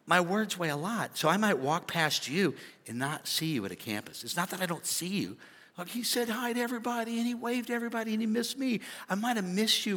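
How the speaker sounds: normal talking voice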